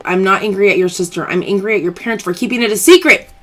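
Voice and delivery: ordinary talk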